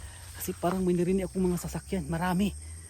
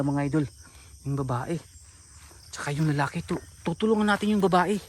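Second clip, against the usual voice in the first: ordinary talk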